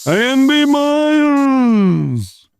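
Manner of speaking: high, slow name call; slow drawn-out announcer. Pretend. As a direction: stretch it like a ring announcer.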